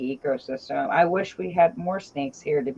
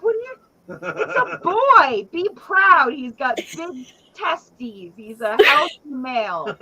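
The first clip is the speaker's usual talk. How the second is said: mock proud aunt; 8 semitones higher, 4 dB louder than usual. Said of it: "I'm like".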